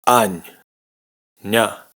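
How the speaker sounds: normal talking voice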